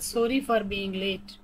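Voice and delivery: very slow, every word separated; robotic slow reading. Shown as a demonstration. Teacher modelling an apology for lateness, slow and robotic. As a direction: spell it out evenly like a machine. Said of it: "थोड़ा robotic वे में पढ़ के बता रही हूँ".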